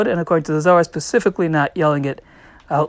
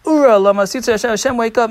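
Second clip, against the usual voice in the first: quick, raised mock yell to heaven; imitated yell; 5 semitones higher, 4 dB louder, faster than usual. Levium call on God in the Temple, imitated yelling. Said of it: "and yell".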